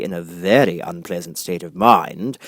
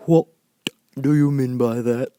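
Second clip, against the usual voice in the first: stern caterpillar; 5 semitones higher, 4 dB louder than usual. A narrator's reading. Caterpillar questions Alice, mildly stern. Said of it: "said the caterpillar sternly".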